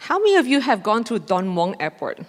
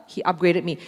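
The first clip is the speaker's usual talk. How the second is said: usual speaking voice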